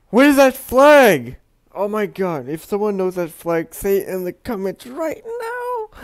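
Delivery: high, loud astonished yell; near-crying excitement at a weird flag; excited shout. Real, not acted. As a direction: shout it at the screen in disbelief. Startled by unknown flag.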